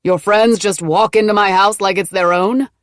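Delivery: loud, angry man's voice. A narrator's reading. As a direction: rage at the intrusion. Cam furious at intruding friend.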